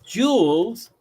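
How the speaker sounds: "joules" with a J sound, stretched out; British-style pronunciation. Retold or imitated. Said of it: "they say joules".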